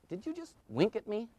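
hushed, high-pitched query; tentative, soft and high; imitated careful therapist. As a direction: ask it gingerly, softly. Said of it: "and I said".

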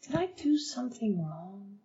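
hushed, almost whispered sincere question; sincere and soft. Shown as a demonstration. Asking a cold coworker gently what's wrong, in a hush. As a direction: ask it gently, barely above a whisper. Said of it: "And do it so sincerely".

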